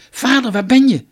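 shouted high and loud into the woods; calling out. Acted. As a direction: call out to a lost father. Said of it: "riepen ze". (luid en hoog roepend)

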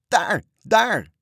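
very high, loud lookout's shout; sudden cry. A narrator's reading. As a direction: cry out as if spotting land. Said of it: "riep Marinka opeens".

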